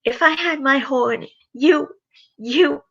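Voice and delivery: high choked fury; furious Rarity. Acted. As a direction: sputter at the murderer. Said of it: "Rarity expressed her fury".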